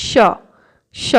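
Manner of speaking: স as tense double sh, first part weak; doubled শ. Shown as a demonstration. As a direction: press স into a strong ssh. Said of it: "প্রথম শটা একটু কম উচ্চারণ হবে". (শ্শ, প্রথম শ-টা কম)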